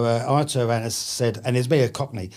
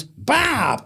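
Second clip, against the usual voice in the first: high mock American "Bob", said as "Bahb"; exaggerated Washington vowel on "Bob"; Washington accent; 11 semitones higher than usual. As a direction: imitate the Washington man failing at "Bob". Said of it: "he couldn't do it".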